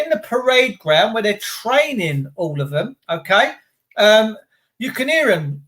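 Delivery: ordinary talk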